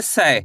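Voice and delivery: "सै" drawn out as one syllable, pitch falling low; ai-matra demonstration. Shown as a demonstration. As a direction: say "सै" with the ai vowel, sliding down. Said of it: "सा का उच्चारण कैसे करेंगे". ("सै" का स्पष्ट उच्चारण, गिरते सुर में)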